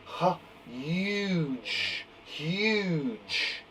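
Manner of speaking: Huge said softly and slowly, leaning on the yoo; teacher modelling Huge for children to hear the U; slow model word. Shown as a demonstration. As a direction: draw out Huge so the U is clear.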